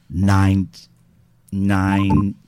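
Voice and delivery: shouted flat nine into the mic; loud robot voice attempt. Shown as a demonstration. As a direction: bark the number for the voice changer. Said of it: "in a robot voice".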